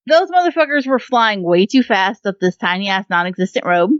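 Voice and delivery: ordinary talk, nothing marked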